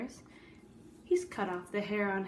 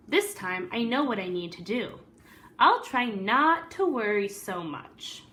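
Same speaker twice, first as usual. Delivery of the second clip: lively read-aloud storytelling voice; story narration; 2 semitones higher, 6 dB louder than usual. A narrator's reading. Teacher reading a picture book to the class, storyteller voice.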